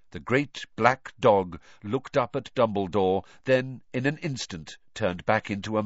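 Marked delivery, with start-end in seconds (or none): none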